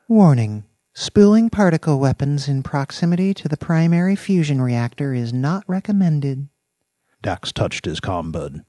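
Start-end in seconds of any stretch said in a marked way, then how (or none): 0.1-6.5 higher synthetic female AI voice; computer voice. A narrator's reading. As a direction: speak like a cool station computer. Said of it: "the same female voice ... came over the warehouse comm".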